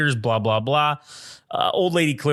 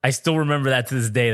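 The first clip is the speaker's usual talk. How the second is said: normal talking voice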